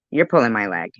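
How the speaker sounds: normal talking voice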